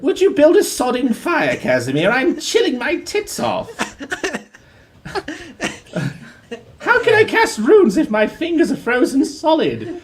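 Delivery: high, indignant posh British complaint; hammed-up British whining about the cold. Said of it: "Ragnar has a posh British accent".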